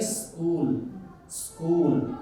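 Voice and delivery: स्कूल with the s hitting the teeth; correct pronunciation. Shown as a demonstration. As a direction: stress the dental s in स्कूल. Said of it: "ये दंत से टकराएगा". (स्कूल, दाँत से टकराता स)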